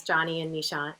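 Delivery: ordinary talk, nothing marked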